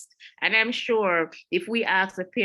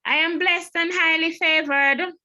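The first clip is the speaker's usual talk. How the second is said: much higher, louder churchy voice; pious stock reply; 11 semitones higher, 6 dB louder than usual. Retold or imitated. Edith answering the greeting, high and pious. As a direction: recite it like a sanctified church lady. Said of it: "And Edith's response is".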